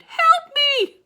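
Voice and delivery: shout for help. A narrator's reading. Otis yells for help amid escaping animals, loud shout. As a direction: cry out over the chaos. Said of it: "and Otis shouted".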